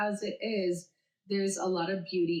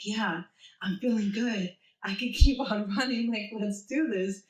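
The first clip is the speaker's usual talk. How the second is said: louder, upbeat self pep talk; acted second wind; 3 semitones higher, 5 dB louder than usual. Runner replaying her thoughts at mile six, pumped up. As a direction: re-enact a runner getting fired up.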